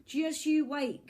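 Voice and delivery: high, slow hollered threat. Acted. Lonely boy hollers a threat across the beach, slow. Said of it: "he hollowed".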